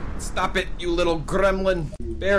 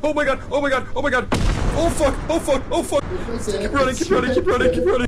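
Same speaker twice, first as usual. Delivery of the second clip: real terror shrieks, half laughing; panicked screaming; 9 semitones higher, faster than usual. Real, not acted. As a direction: scream it like something is right behind you. Fleeing a monster, screaming.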